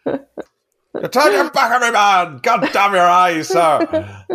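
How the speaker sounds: high, drawn-out mock captain's yell; mock bellow breaking into laughter at the end; salty sailor shouting. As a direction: imitate an outraged officer, slowly and hammed up. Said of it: "it's incredible salty sailor talk".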